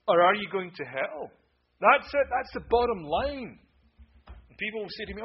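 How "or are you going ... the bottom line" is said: louder and higher, then a slower final line; preacher confronting the hearers about hell; preaching climax. Acted. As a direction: hammer the question home. Climax of the sermon question.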